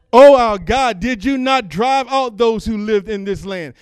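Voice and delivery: loud, high, slow preached plea; preacher voicing King Jehoshaphat's prayer, shouting it high and loud; impassioned prayer. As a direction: cry it out to God at full force. Preaching prayer at full force.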